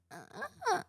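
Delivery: high, soft little newborn grunts; baby grunts imitated. Mother imitating her newborn's grunts in the OR, high and soft. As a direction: mimic the baby's weak grunting. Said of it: "she goes, like".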